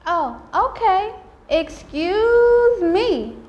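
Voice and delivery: high, drawn-out mock surprise; slow, exaggerated "well, pardon me" attitude; sarcastic comeback. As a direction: stretch it out with sassy, lifted pitch.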